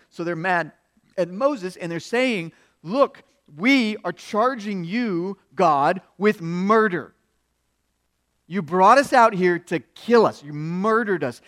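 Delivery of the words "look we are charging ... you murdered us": slow, emphatic, loud accusation; acted-out crowd's indictment; mad, accusing. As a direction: lay a formal charge in anger. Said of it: "The people of Israel are mad at God".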